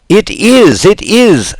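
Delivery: high, loud excited shriek. Acted. Signora Rosaura peeking from the wings, shrieking. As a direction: squeal it out in joy. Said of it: "shrieked Signora Rosaura".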